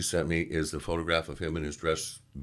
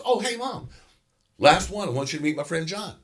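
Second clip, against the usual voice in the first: higher, louder eager hello; excited call-out; 11 semitones higher, 4 dB louder than usual. Retold or imitated. Dan turning to introduce his friend to Mom, eager higher call. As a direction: call across the room to Mom.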